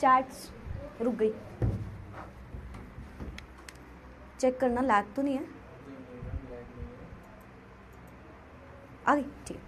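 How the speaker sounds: very soft, low muttered aside; quiet aside. Real, not acted. Teacher checking her stalled chat to herself, in a quiet aside.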